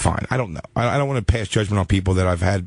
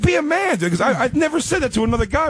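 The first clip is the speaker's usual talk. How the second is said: mock scolding friend. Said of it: "He's like".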